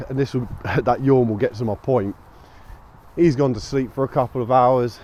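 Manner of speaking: ordinary talk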